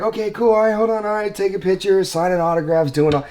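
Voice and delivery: high, hurried fluster; overwhelmed, trying to keep up; flustered, mobbed by fans. Retold or imitated. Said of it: "I'm just like".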